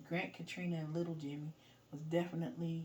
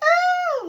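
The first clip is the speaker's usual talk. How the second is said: very high loud shriek; 17 dB louder than usual. Retold or imitated. Imitating the barber seeing the roach, a shriek. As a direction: yelp of fright, high and loud. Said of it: "She was like".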